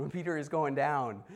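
usual speaking voice

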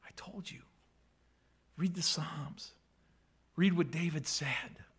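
urgent whisper; hushed reminder. Acted. Jesus whispers to the disciples to read the Psalms. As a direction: breathe it as a secret.

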